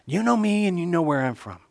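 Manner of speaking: soft, playful mockery; facetious. Jesus toying with the doubters' claim, facetiously. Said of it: "Christ is being facetious".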